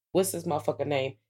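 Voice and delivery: ordinary talk, nothing marked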